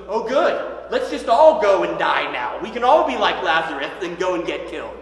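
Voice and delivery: bitter sarcasm, the middle line shouted out; mock-cheerful then a loud scoffing yell; sarcastic outburst. Thomas scoffing at the disciples, sarcastic and loud.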